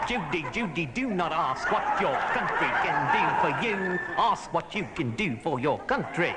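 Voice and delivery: "Judy, Judy" in a hammed-up Cary Grant voice; Cary Grant style. Shown as a demonstration. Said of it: "that's very good, [name]".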